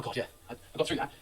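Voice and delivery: hushed, breathy sigh of relief; half-whispered relief to yourself; relieved inner voice. Retold or imitated. Nervous trainee relieved after answering the judge, whispered. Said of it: "you think".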